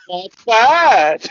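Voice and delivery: loud, high-pitched mock verdict; mock exclamation. Imagined musicians reacting after the tapas bar, hammed-up exclamation. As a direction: call it out high and loud, hamming it up.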